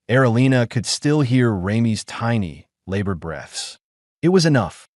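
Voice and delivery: usual speaking voice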